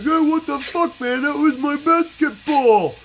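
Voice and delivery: put-on high, whiny teenage voice; whiny kid voice. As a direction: squeal it like an outraged kid. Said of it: "the kid that threw it was just like".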